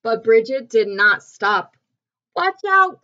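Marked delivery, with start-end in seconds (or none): 2.4-3.0 high, louder warning call; urgent storybook call; cried warning. A narrator's reading. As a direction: cry out a warning as the character. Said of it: "cried Missus Pickles".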